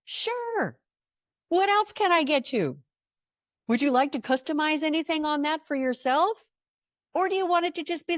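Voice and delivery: high, eager drive-through clerk; bright intercom voice taking the order; cheery drive-through voice. Retold or imitated. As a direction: sing out the offer like a helpful server. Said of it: "The disembodied voice says".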